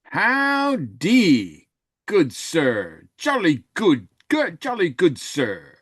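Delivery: a cheesy mock English gent; bad English accent. Co-host greeting the host in a mock English accent. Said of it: "my English accent is only slightly better".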